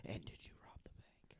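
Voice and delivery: barely audible whispered question; sneaky whisper. Acted. Cop sneaks confession question past suspect, whispered. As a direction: slip it in under your breath.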